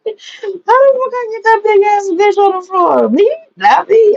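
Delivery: high-pitched, baffled protest; incredulous denial. Acted. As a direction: protest your innocence in a high voice. Disbelief at being blamed.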